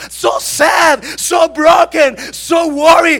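high, shouted sermon delivery; full-force preaching. Acted. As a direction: thunder out God's reassurance to an embattled believer. (prédica a gritos, con voz aguda y potente)

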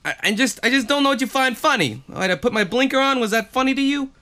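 a light mock Joe Pesci taunt; Joe Pesci impression. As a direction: needle him fast like a Goodfellas hothead. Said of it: "Joe Pesci".

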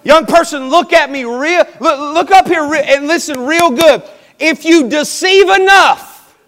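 loud, high preaching voice; forceful exhortation. Real, not acted. Preacher telling a young person to listen, forceful.

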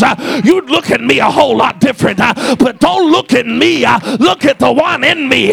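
loud, driving preaching with huffed uh breaths; full-force preaching. Acted. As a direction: shout it to the back pew.

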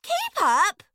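very high, squeaky order; grapefruit character. A narrator's reading. Grapefruit hurries the fruits behind, squeaky. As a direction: pipe it up loudly. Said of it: "said the grapefruit".

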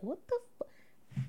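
imitating a stunned, whispered reaction; slow, voiceless gasp of disbelief, cut off.

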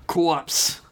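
Corpse said with Boston open aw; Boston accent. Retold or imitated. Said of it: "she says".

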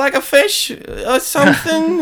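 high, slow, loud hammed-up caricature; put-on voice. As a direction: ham up a strange clerk offering fish.

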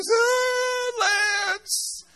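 her cry of the name. Retold or imitated. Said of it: "And she goes".

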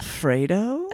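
high, rising, questioning; tentative guess. Retold or imitated. Neighbour guessing an Italian name, tentative. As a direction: venture it as an unsure guess.

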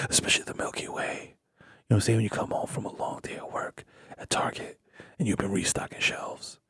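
husky seductive whisper. Acted. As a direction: breathe it close to the mic.

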